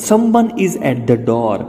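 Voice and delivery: very slow, each word apart; teacher repeats it slowly for students; slow model sentence. Shown as a demonstration. Slow drilled sentence for learners. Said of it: "આનો ઉચ્ચાર થશે".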